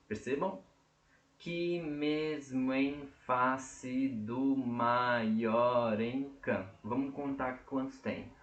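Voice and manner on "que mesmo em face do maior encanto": very slow, "mesmo em" and "maior encanto" run together; joined vowels, slow. Shown as a demonstration. Teacher showing students how the vowels join, very slow. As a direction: glide "mesmo em" into one sound, slowly. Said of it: "Olhem, percebam aqui".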